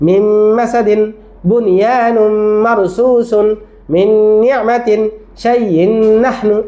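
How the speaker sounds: slow, even reading of each merged pair; steady, deliberate reciting pace to show pupils; slow recitation. Shown as a demonstration. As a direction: model the children's slow reading. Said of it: "এভাবে দিবে".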